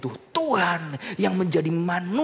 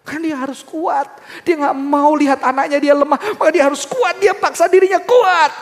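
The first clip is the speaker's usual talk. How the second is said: loud, high, insistent preaching; rising preacher's fervour; full-force preaching; 13 semitones higher, 11 dB louder than usual. Acted. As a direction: hammer home the point. Preaching builds on being strong.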